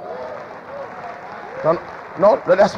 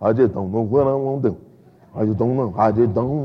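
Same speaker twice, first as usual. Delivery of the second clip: low, mopey, helpless mumble; mock the self-pitying worrier, lower and sagging; a hint of hopeless moaning; despairing whine; 9 semitones lower, 3 dB quieter than usual. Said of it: "sitting around going".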